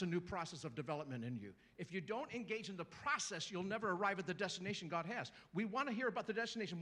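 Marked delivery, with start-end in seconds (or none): none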